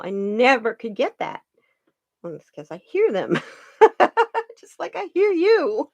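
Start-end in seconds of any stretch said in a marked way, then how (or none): 3.8-5.9 high laughing burst, then words through it. Real, not acted. Laughing while telling a listener she hears animals. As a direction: laugh it off, half amused.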